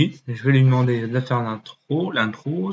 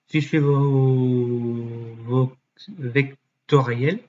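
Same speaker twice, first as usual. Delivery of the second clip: sounding it out; much slower than usual. Real, not acted. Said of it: "sounding out a hard word".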